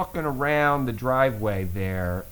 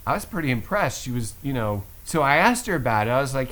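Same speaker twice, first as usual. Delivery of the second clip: normal talking voice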